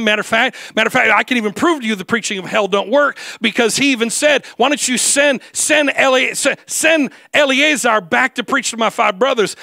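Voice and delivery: ringing pulpit voice; full-force preaching. Acted. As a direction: drive it home to the congregation.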